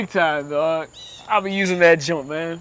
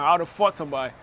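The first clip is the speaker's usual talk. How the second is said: normal talking voice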